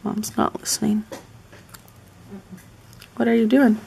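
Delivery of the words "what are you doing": loud call to someone in another room; calling out again. Real, not acted. Calling to her mom a second time: loud call. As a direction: raise the voice to reach Mom.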